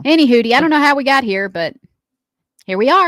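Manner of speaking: loud cheery segment wrap-up; upbeat wrap-up. Real, not acted. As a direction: boom it out to close the segment. Host wrapping up segment.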